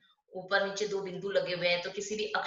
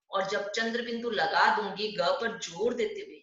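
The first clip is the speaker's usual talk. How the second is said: usual speaking voice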